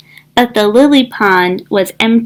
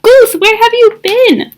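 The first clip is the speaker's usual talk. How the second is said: much higher relieved question; 11 semitones higher, 3 dB louder than usual. A narrator's reading. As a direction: glad and relieved. Duck relieved.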